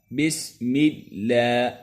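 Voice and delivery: اللہ with a thin light lam after the kasra, drawn out; thin lam in Allah. Shown as a demonstration. Teacher demonstrating thin lam in بسم اللہ. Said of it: "باریک پڑھتے ہیں".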